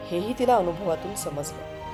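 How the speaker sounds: ordinary talk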